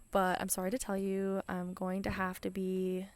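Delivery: usual speaking voice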